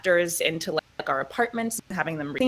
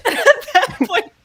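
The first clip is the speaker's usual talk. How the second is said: high, loud laughing disbelief; 9 dB louder than usual. Real, not acted. Actress laughing about nobody wanting her role, high and loud. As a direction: laugh through the outrage. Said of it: "You like her?".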